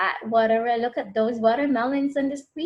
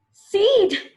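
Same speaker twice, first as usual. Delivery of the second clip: high, loud alarmed yelp; startled cry; 10 semitones higher, 6 dB louder than usual. Acted. Connor feels a seed in his mouth, alarmed. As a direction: catch the seed in surprise.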